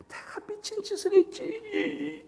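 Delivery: high, slow, tearful and soft; mother crying in regret. Retold or imitated. Mother weeping over leaving her son, tearful regret. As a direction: let it break like a weeping mother. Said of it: "후회하시면서 우시는 거예요".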